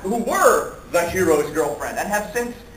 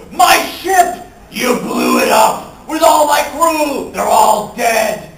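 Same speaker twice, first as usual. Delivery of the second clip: loud, drawn-out comic wail; mock anguished outrage; 4 semitones higher, 7 dB louder, slower than usual. Scoundrel seeing his ship blown up, anguished outrage. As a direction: shout each loss in hammed-up horror.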